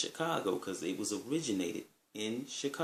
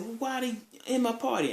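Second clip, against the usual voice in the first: very high-pitched imitated complaint; mock indignation; 13 semitones higher than usual. Party host objects to friend's guests, indignant. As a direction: whine back as the put-out host, voice way up. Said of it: "flipped out and said".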